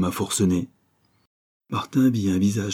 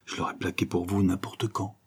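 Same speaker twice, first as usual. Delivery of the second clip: much softer, confiding; lowered voice; 6 dB quieter than usual. Acted. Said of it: "Elle baissa la voix". (voix baissée, confidence bien plus douce)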